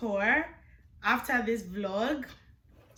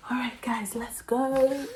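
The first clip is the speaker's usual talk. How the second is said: whispering; 3 semitones higher than usual. Real, not acted. Whispering as she heads out.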